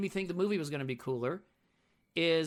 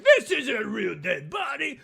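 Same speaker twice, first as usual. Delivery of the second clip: slow, loud, higher dudebro scoff; scoffing jock yell; mock jock shout; 6 semitones higher, 7 dB louder than usual. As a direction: bellow it like a dismissive party jock. Quoting the jock party dude.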